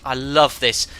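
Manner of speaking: ordinary delivery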